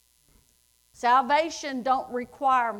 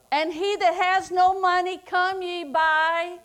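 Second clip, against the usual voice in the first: higher, louder preaching call; 6 semitones higher, 6 dB louder than usual. Acted. Preacher calls the congregation to come, higher and louder. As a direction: lift it up and call them in.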